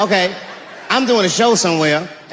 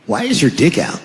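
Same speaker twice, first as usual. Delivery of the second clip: dumb last words; 3 semitones lower than usual. Acted. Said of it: "their last words are always the dumbest".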